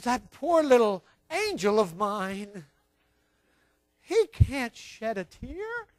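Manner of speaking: slow, doting mother's voice; tender-hearted mother. Acted. Tender-hearted mother pitying her crying baby, slow doting voice. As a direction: coo it slowly, full of pity for the little one. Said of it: "the tender-hearted mother ... looks and says".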